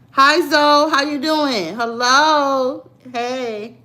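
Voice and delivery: high, loud hello to a newcomer; bright greeting. Real, not acted. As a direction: call out a drawn-out friendly hello. Greets someone arriving mid-stream.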